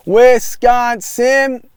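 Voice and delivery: slow, high, loud "Wisconsin" stretched out; a drawn-out cheer of the state name. Real, not acted. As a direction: belt out "Wisconsin" proudly, slowly. Showing off Wisconsin shirt.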